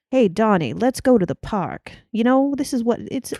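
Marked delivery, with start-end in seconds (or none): 0.1-1.9 put-on Mid-Atlantic lilt; Mid-Atlantic accent. Shown as a demonstration. Showing friends the accent, Mid-Atlantic voice. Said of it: "Mid-Atlantic accent".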